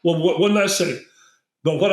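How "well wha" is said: ordinary delivery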